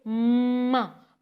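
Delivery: माँ held long and slow, lips closing on the म; drawn-out demo. Shown as a demonstration. Therapist showing a lip sound, माँ drawn out slowly. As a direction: stretch माँ slowly so the child sees the lips. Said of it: "माँ की sound जो है".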